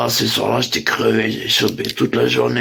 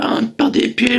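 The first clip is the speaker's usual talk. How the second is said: ordinary talk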